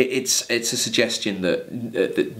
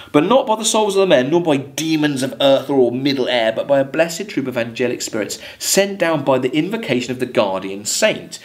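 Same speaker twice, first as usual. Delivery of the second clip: poem reading; 3 semitones higher than usual. Acted. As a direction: lift the verse for the class. Teacher reads poem lines aloud.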